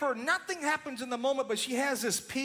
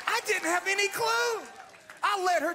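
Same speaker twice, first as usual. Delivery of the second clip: excited; 9 semitones higher, 6 dB louder than usual. Real, not acted. Still laughing after punchline.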